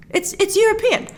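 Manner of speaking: high, laughing take on a viewer; laughing mock viewer. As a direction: say it through a laugh. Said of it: "You're like".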